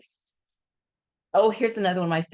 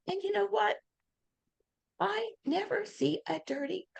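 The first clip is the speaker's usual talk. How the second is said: lightly voiced Mary Kay, higher and softer; high imitation of Mary Kay; 12 semitones higher, 8 dB quieter than usual. Mary Kay warning consultants about dirty pink cars, mildly imitated. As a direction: mimic her mock-stern warning up high. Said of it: "That's exactly how she did it".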